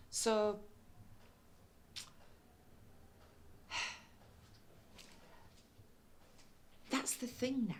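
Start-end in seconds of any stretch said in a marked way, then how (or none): 2.7-6.8 long, soft sigh; weary sigh. Acted. Daughter caring alone for her mum, weary sigh. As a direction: let out a long, quiet breath.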